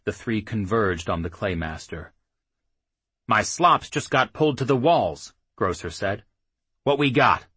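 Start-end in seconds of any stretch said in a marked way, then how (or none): 3.3-7.5 put-on gruff old soldier. A narrator's reading. Grocer, old rider.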